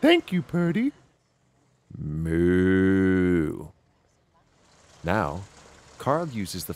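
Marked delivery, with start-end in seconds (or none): 0.0-0.9 much higher cartoon cow voice; a squeaky grateful cow. Cow thanking Purdy, much higher voice. 2.0-3.7 drawn-out imitated moo; cow moo. Cow mooing after eating, drawn-out moo.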